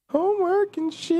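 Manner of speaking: way up high, squeaky and teasing; tiny squeaky voice mocking the homework line. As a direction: squeak it back like a cartoon.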